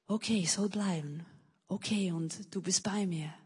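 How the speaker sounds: soft, hushed, settled resolve; quiet inner voice. Retold or imitated. Guest voicing her calm inner decision to stay, softly. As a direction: let it fall to a calm near-hush.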